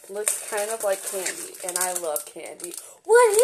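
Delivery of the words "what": loud, high, slow astonished question; excited surprise. Real, not acted. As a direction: gasp it out amazed. Excited discovery of candy.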